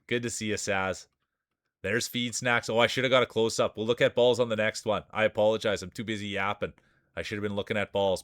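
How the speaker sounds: ordinary delivery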